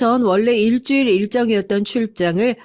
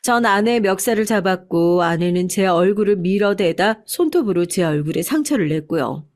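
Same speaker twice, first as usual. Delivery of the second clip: usual speaking voice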